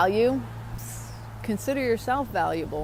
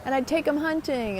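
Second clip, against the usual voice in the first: put-on excited old man; 6 semitones higher than usual. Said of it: "He goes".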